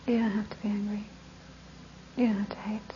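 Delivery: whispered calm reply; quiet. Retold or imitated. Teacher answers the angry boy quietly and calmly. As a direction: say it softly, without anger. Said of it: "I was sitting there quiet".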